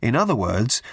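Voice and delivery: usual speaking voice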